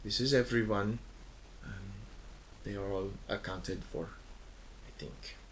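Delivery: fading to a hushed, unsure "I think"; unsure captain. Acted. Bebo answering the guard, trailing off unsure. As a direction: trail off quietly, doubting. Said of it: "Bebo kind of looks and goes".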